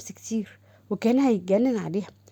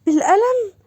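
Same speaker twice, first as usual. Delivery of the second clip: high, louder outraged echo; can't believe what she heard; shocked echo; 10 semitones higher, 6 dB louder than usual. Acted. Sister hears she was slapped. (بالقلم بصدمة وصوت عالي)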